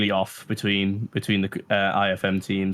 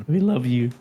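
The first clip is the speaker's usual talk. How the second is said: voice cracking; 3 semitones higher than usual. Real, not acted. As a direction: voice cracks mid-phrase. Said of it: "Oh my God, my voice".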